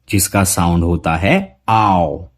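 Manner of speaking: ordinary talk, nothing marked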